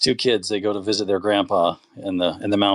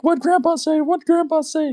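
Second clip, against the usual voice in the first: put-on much higher, pleading kid voice; desperate kid. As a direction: plead anxiously in a squeak. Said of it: "he's like".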